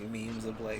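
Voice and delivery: ordinary talk, nothing marked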